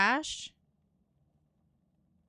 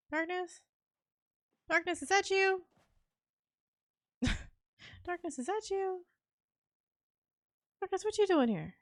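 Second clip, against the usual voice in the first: high calling voice; 9 semitones higher than usual. Acted. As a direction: call out in a high singsong, searching. Calling for hidden teammate, high voice.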